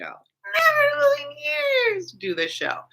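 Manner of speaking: very high, drawn-out squeal on never; exaggerated squeal. Real, not acted. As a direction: stretch it into a comic high-pitched wail.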